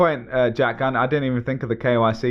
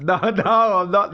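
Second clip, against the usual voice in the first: higher, offended protest; mock offended; 7 semitones higher, slower than usual. Real, not acted. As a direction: protest as if insulted. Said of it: "Don't insult me".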